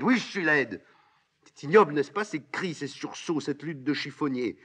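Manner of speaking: high, agitated defiance; crying out. Acted. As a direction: throw it back in a high, heated voice. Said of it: "criant ces mots". (cris de révolte, voix haut perchée)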